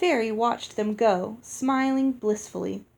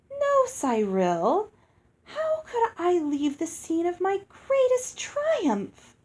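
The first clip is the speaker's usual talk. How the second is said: high, slow, sing-song fairy; musical fairy voice; 9 semitones higher, slower than usual. A narrator's reading. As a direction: lilt it like a pleased, vain fairy. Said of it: "Her voice was musical".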